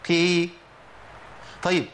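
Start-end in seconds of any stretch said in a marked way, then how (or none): 0.1-0.5 قي heavy but pulled toward lightness; weakened قي; weakened kasra qaf. Shown as a demonstration. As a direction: say قي weaker, not fully light. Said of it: "تبقى ايه؟".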